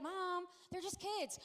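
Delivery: put-on slow whine of a put-upon kid; exasperated son. Son protesting mom's questions, exasperated.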